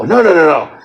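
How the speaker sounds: ordinary talk, nothing marked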